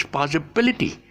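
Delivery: possi-BIL-ity, stress before the suffix; shifted stress. Shown as a demonstration. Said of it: "they shift it before the E T".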